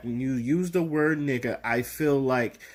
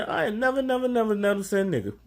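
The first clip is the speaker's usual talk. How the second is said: higher, insistent "never, never, never" in fake innocence; mock innocent denial; 8 semitones higher, faster than usual. Lying to his friends about letting someone say it, higher and insistent. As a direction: protest your innocence a bit too much. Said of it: "I'm lying. I say".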